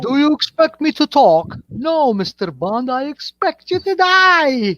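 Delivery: high, theatrical movie quote, drawn out; hammed-up villain's slow death threat; Bond film impression. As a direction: act out the Bond showdown, building to a high finish. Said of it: "best part of all James Bond movies".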